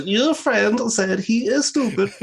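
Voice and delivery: high, loud, slow mock accent; Borat. Player voicing his game character, overdone accent. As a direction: overexaggerate every word in a foreign accent. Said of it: "nearly like Borat".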